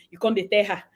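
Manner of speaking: ordinary talk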